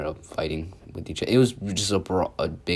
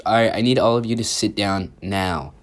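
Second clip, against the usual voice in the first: louder, stern order to sit; mean teacher; 3 semitones higher, 7 dB louder than usual. Retold or imitated. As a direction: imitate a mildly mean teacher laying down the law. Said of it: "you ain't gotta be mean about it".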